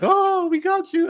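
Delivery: high, loud, teasing mock reveal; mock gotcha. Mocks the film springing its twist.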